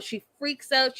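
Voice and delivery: ordinary delivery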